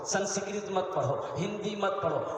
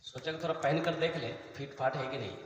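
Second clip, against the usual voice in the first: ordinary talk, nothing marked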